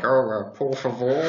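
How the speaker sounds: mock timid Englishman trying Spanish; hesitant English Spanish speaker. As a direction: imitate a bumbling English tourist. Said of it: "English people speaking Spanish are like".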